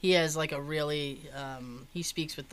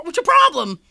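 mock-exasperated, louder and higher; exasperated granddad; 8 semitones higher, 8 dB louder than usual. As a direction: act out an impatient granddad. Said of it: "the granddad's like".

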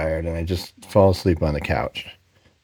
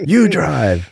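high, mock barked order; retold angry order, pitched up; agitated gunman; 8 semitones higher than usual. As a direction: snap out a command like a rattled robber. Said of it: "he gets really agitated".

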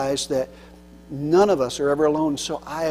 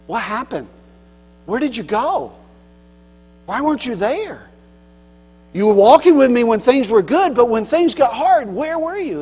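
higher, rising plea to the Lord; pleading questions; 8 semitones higher, 7 dB louder than usual. Retold or imitated. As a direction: ask like a hurt, confused man. Poem's fellow questions the Lord.

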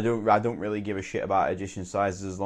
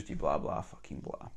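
muttered; 7 semitones lower, 9 dB quieter than usual. Acted. Muttering dismissive mock questions.

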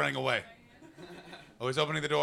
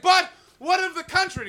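much higher, louder fussy protest; prim worrier; 13 semitones higher, 7 dB louder than usual. Retold or imitated. Al Gore fretting to Bill Clinton, high and prissy. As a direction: fret like a prissy sidekick. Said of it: "And he's like".

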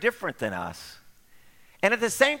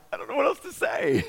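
higher, laughing through the words; laughing awkwardly; 13 semitones higher than usual. Retold or imitated.

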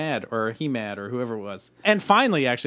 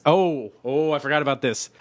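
ordinary talk, nothing marked

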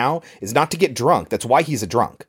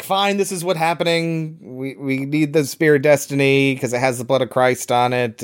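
higher, hurried confession from a beaten demon; panicked villain; 4 semitones higher than usual. Retold or imitated. Balthazar caving to John, higher-pitched and frantic. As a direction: spill it all in a frightened rush. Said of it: "he's like".

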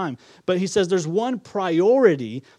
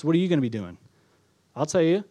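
normal talking voice

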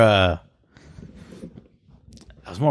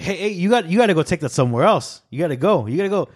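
slightly higher firm shooing; mock shop worker; 6 semitones higher, faster than usual. Friend voices a shop worker sending her away, lightly higher. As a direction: tell her to move along. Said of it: "they're like".

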